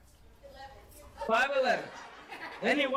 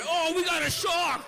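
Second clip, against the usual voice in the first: high, raised mock exclamation; 6 semitones higher, 4 dB louder than usual. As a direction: cry out like a thrilled swimmer.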